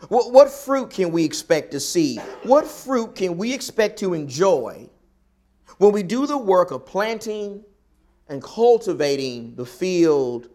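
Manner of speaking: normal talking voice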